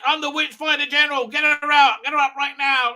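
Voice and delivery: high, loud mock commander; Graham. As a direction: shout orders as a self-important witch hunter, hammed up. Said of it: "then Graham does that".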